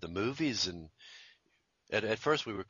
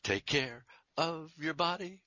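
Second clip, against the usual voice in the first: mock sexy ad voice; 7 semitones higher, slower than usual. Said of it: "someone would say something".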